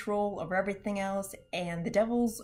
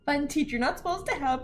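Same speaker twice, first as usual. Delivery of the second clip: ordinary talk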